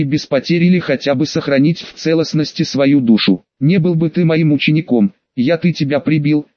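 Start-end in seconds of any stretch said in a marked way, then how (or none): none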